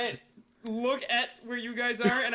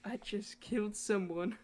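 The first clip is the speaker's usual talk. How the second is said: soft, slow confession in his ear; mock whisper; 4 semitones lower, 8 dB quieter, slower than usual. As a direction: murmur it slowly, as a hushed secret. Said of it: "whispers in his ears".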